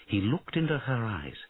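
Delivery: ordinary delivery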